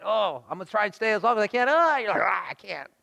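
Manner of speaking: hammed-up struggle, rushed then crying out; straining against glare. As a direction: act out being blinded by the sun. Acts out staring into the sun.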